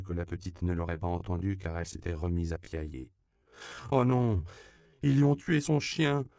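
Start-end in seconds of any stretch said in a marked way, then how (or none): 3.9-6.3 high, loud lament over a dead dog; keen it like a heartbroken child; high child's wail, louder throughout; wailing girl. A narrator's reading. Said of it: "gémit-elle de sa voix aigrelette et voilée".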